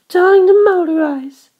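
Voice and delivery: put-on a vain girl introducing herself; high proud girl. A narrator's reading. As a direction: announce your name like a little princess.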